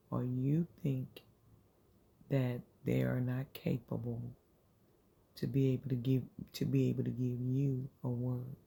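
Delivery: ordinary delivery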